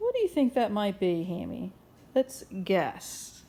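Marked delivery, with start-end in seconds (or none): none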